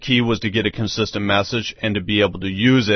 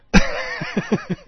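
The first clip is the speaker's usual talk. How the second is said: breathy chuckle; 9 semitones higher than usual. Real, not acted. Trailing laugh after the remark.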